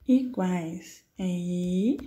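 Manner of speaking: Iguais é i stretched out very slowly, rising on i; slow syllable marking. Shown as a demonstration. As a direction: draw out the i of Iguais. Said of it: "marks the syllable i". (marcando a sílaba i bem devagar)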